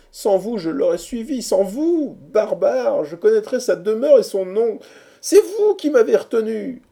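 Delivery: high accusing outburst, building to a peak; loud reproach. A narrator's reading. Hero blames friends for losing her. (reproche véhément qui monte)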